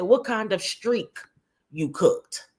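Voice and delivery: drawn-out "streak" in place of steak; mispronounced steak. Retold or imitated. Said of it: "She said".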